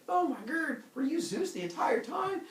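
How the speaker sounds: high, astonished mock-girl voice. Girl discovers the swan was Zeus, high astonishment. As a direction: exclaim it high in hammed-up amazement.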